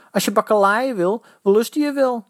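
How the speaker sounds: high, loud dare; taunting. A narrator's reading. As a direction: goad him into a fight. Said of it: "tartte de slagersknecht".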